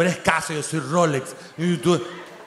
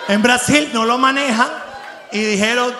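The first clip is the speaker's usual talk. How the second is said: ordinary talk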